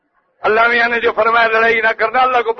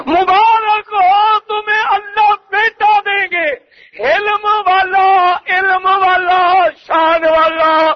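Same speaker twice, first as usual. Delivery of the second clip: impassioned preaching; 8 semitones higher, slower than usual. Acted. Angels' glad tidings preached.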